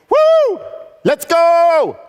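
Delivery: very high whoop, then a loud drawn-out yell; whooping war cry. Acted. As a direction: whoop and holler to kick off the day. Acts out jumping out of bed.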